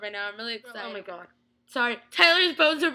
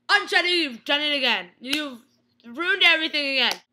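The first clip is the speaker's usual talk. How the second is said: higher, louder exasperated scolding; mock exasperated; 4 semitones higher, 6 dB louder than usual. Fan scolding the aunt on screen, exasperated and louder. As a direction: scold her for doing it again. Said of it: "Start freaking out completely".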